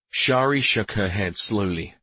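plain reading voice

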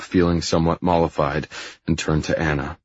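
usual speaking voice

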